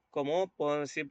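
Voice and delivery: normal talking voice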